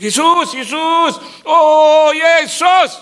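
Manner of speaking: long, high drawn-out wail; mock desperate shouting. People crying to Jesus once trouble hits, mock desperate shouting. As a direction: cry out slowly and loudly, far above normal pitch. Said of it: "baru teriak-teriak".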